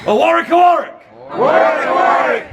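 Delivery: high, loud shouted chant; full rallying cry; loud battle cry. Shown as a demonstration. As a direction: bellow it across the courtyard. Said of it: "You better be loud now".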